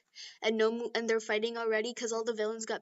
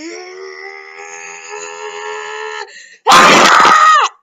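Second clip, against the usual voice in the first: long high strain bursting into a very loud scream; power-up scream; 6 semitones higher, 17 dB louder than usual. Acted. Deku activating One for All, building scream. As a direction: build a drawn-out yell until it explodes.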